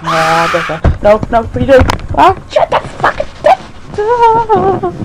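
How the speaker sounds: panicked screaming, high and loud; terrified horror victim. Real, not acted. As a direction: shriek and plead in real terror. Chased by monster in game.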